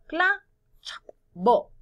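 "cla, chap, bo" with a quick chop noise between beats; syllables cut apart. Shown as a demonstration. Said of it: "Vamos a separar". ("cla, chap, bo" cortando las sílabas)

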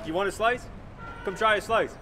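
usual speaking voice

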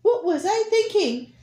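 high, loud, squeaky anteater. Acted. Anteater voiced in story.

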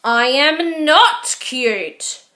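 slow, loud furious shout. Acted. Baby Owl shouting at Squirrel, loud and slow. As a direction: shout it out, outraged. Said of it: "shouted Baby Owl".

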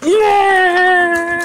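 long, high drawn-out cheer; high whoop held out; triumphant cheer. Real, not acted. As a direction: celebrate a small victory at length. Gets the packaging open at last.

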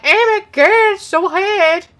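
mock-dramatic whine; mocking whiny gamers. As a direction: mimic the YouTubers complaining. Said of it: "they're like".

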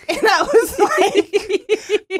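high, loud, talking through laughter; cracking up mid-sentence; laughing. Real, not acted. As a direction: barely get the words out for laughing. Cracking up at her own story.

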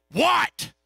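put-on high, loud barking old-man reply; gruff old man. Preacher voicing the grumpy patient answering him: loud, high bark.

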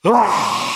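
a loud wailing cry torn out of the chest; bursting sob. Retold or imitated. 감격에 울음이 터지는 소년: 크게 터지는 울음소리. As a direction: let out one big sudden wail of weeping. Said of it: "하고 울음이 터".

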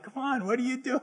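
high, teasing mock protest; playful exasperation. Dad teasing his kids who dodge mom's rules, high and mock-exasperated. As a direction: catch the kids out, half joking. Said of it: "and I'll be like".

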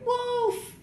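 high falling cry of wolf; a boy crying out for help; cried out. A narrator's reading. Boy crying wolf to skip his bath, high shout. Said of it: "he would cry".

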